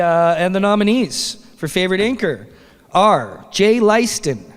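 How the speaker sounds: normal talking voice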